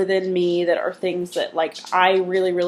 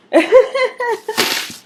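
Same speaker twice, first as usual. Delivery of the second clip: laugh; 6 dB louder than usual. Real, not acted. Sign-off laugh.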